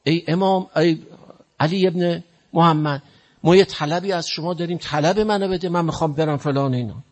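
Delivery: high calling-out voice, imitating the man's cry; retold shout. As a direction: call out to the imam across the gathering. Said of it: "داد زدم".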